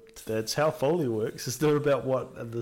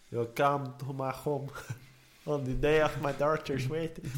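mock mafia boss in an Italian-Mexican accent; muddled Italian-Mexican godfather accent; 2 semitones higher than usual.

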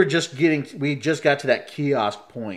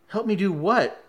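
incredulous question; 7 semitones higher than usual. Retold or imitated. Puzzled man questions the guard.

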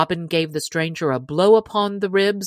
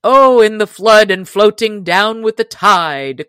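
loud, higher storybook call; good-humoured cry; 4 semitones higher, 7 dB louder than usual. A narrator's reading. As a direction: call back cheerfully across the water. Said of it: "cried Robin".

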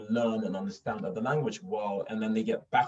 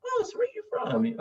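put-on curious old man. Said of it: "he was like".

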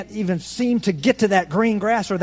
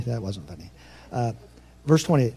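usual speaking voice

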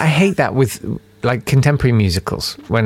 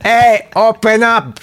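put-on loud high Mexican accent shout; 11 semitones higher, 5 dB louder, slower than usual. Cop at the door in a Mexican accent. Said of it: "I'm doing a Mexican accent".

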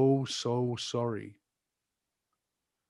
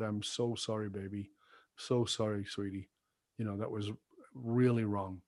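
tearful apology; 3 semitones lower, 7 dB quieter than usual. Retold or imitated. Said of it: "I had tears in my eyes".

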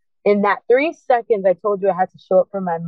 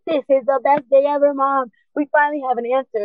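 put-on higher, excited teenager to mom; eager, raised-pitch good news; retold thrill; 6 semitones higher than usual. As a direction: relive being thrilled at finally knowing. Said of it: "I was freaking thrilled".

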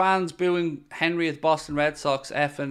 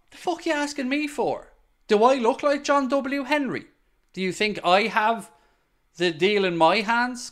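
exasperated outburst; 7 semitones higher than usual. Real, not acted. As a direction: voice jumps high in exasperated disbelief. Snaps back at a viewer's question.

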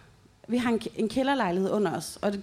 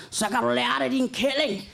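retold furious yell; 3 semitones higher than usual. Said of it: "så kaster han sådan".

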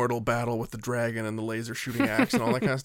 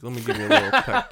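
higher, louder hammed-up mobster peck; mock mobster; 12 semitones higher, 6 dB louder than usual. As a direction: ham up the wiseguy affection. Mock mobster kissing cheek.

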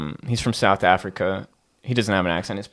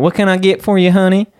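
higher-pitched, sweet Southern hostess voice; Southern accent; 10 semitones higher, 4 dB louder, faster than usual. Acted. Polite Southerner serving a customer, high Southern accent. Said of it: "when you have a Southern accent".

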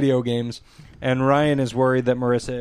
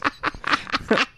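breathy high laugh; 12 semitones higher than usual. Real, not acted. Laughs after mock yell.

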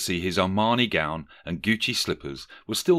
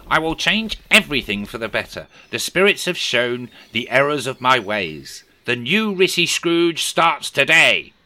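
higher, louder resolute vow; Rishi Scrooge voice; 6 semitones higher, 6 dB louder than usual. Acted. Scrooge vowing to himself, higher and louder. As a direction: Scrooge swearing to reform.

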